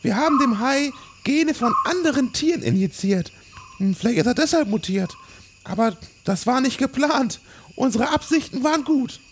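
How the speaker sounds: deutlich höhere, verstellte Forscherinnenstimme; erklärt beschwichtigend als Wissenschaftlerin; hohe Figurenstimme. Acted. Doktor Ye erklärt Projekt. (hohe verstellte Stimme, erklärend)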